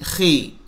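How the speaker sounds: खी light, soft and fine; thin khee. Shown as a demonstration. Teacher shows the fine, thin खी softly. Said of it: "ज़ेर में ये बारीक है".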